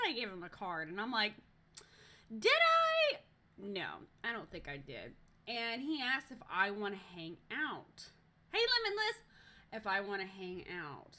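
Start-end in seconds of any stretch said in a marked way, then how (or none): 2.4-3.2 very high, loud mock disbelief; mock incredulous. Retorts to guy's false claim. 8.5-9.2 high, loud hello to a newcomer; calling out greeting. Real, not acted. Greets arriving viewer mid-story.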